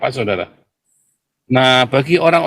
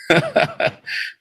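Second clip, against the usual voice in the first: soft chuckle, slightly high; amused little laugh; real laugh; 5 semitones higher, 4 dB quieter than usual. Real, not acted. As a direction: laugh at your own jibe. Laughs at his own jibe.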